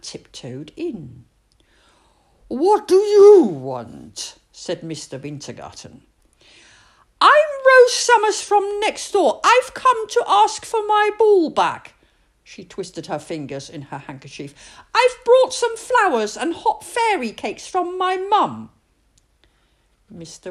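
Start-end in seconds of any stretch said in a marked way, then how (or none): 2.5-4.4 put-on louder, slow, grumpy old man. 7.2-11.9 put-on much higher, louder small girl; high child, politely asking; little girl's voice. 14.9-18.7 put-on much higher, louder small girl; high child, politely asking; little girl's voice.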